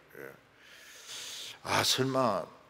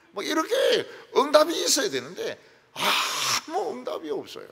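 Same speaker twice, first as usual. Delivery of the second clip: higher, louder emphatic cry; preaching shout; 9 semitones higher, 4 dB louder than usual. Real, not acted. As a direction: drive the point home to the congregation.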